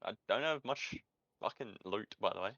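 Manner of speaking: ordinary talk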